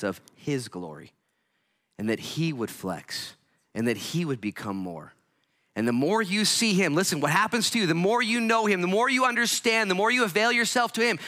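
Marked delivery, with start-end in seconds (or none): 5.7-11.2 high, loud preacher's build; preaching at full force. Real, not acted. Preacher pressing the church to see God, loud and high. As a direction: drive each line higher to the peak.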